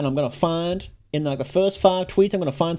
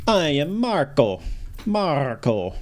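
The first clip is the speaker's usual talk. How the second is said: Marco; much slower than usual. Acted. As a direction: introduce yourself like a stage Italian, drawn out. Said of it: "to do an Italian accent for Marco".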